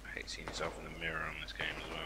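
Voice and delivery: unvoiced whisper, late at night. Real, not acted. Late-night gaming with friends, whispered teasing. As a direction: keep it to a hush so no one wakes.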